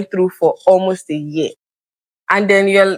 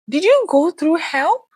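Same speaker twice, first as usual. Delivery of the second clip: put-on higher, drawn-out American-accent question; mimicked American accent; 10 semitones higher than usual. Friend in America asking about help, mimicked American accent. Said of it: "in her accent, I tried to mimic her".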